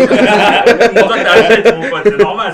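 words bubbling through laughter; laughing. Real, not acted. Teasing his Algerian friend, laughing hard. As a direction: tease him while cracking up.

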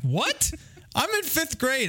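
put-on high-pitched incredulous kid squeal; retold whiny outburst, pitched very high; mock protest. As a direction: protest in disbelief, voice shooting way up. Acting out his protesting younger self.

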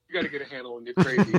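fast, even delivery of Daryl's line; calm firm quote. Retold or imitated. Daryl telling Kelly to handle her crazy, quickly. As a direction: rattle off the line firmly. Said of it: "that's when he said it".

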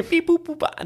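high squeaky alien beeps. Retold or imitated. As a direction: mimic a tiny alien talking. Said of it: "That's how they talked".

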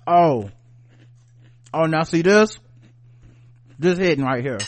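talking through a mouthful; chewing a bite of cheese; mouth full. Real, not acted. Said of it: "Let me get a little bite of this".

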